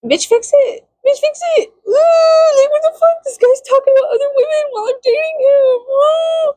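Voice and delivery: squealing high outrage; high mock outrage. To the game devs, high squealing outrage. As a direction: shriek it like a scandalised friend.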